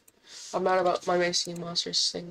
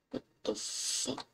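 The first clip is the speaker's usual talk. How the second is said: stunned whisper; 4 semitones higher, 9 dB quieter, slower than usual. Real, not acted. Shocked at empty mall figure.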